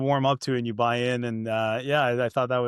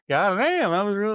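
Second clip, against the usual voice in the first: higher, louder put-on voice of a stunned viewer; audience reacting; 7 semitones higher, 3 dB louder than usual. The audience reacting to the real crash, stunned and loud. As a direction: gasp it out like a moviegoer caught off guard. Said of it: "the audience is with the mom being like".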